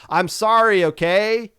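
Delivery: loud, exasperated sorry; angry apology. Pretend. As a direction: shout the apology, fed up. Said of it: "apologizing to Marine Melody".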